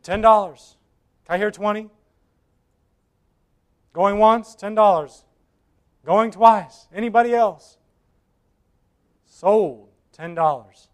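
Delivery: going once, going twice, sold; auctioneer calling it. Acted. Auctioneer closes the sale.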